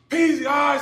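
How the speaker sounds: high, loud holler mimicking a teammate; imitated trash-talk yell. Ex-player retelling a gym showdown, mock yelling. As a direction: burst out as if hyping up the weight room. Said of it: "so he starts cussing him out".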